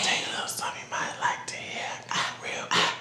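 whispered, fast crooning; whispering sweet nothings. Acted. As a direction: whisper it like a love song. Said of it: "whisper sweet nothings in your ear".

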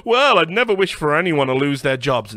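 loud, put-on voice of a well-meaning objector; exaggerated mocking imitation. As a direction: mimic people protesting, loudly and mockingly. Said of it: "a lot of people will go".

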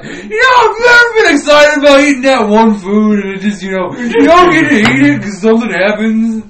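loud, high hammed-up sobbing; mock sobbing. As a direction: wail it like a fake breakdown. Tearful rant over food.

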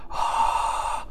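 low, open-throat voiceless whisper; chiaroscuro whisper. Shown as a demonstration. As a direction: whisper with a settled, neutral larynx. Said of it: "If you whisper through that".

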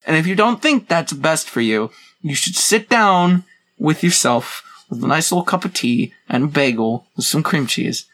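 ordinary talk

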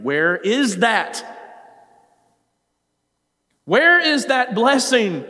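high, loud, slow plea; anguished prayer to God; crying out. Retold or imitated. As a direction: ache for the lost blessing. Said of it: "He's wondering".

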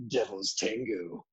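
low, slow, soft signature voice; Devil's Tango voice. Shown as a demonstration. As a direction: drop low and drawl it out slowly. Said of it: "my Devil's Tango voice".

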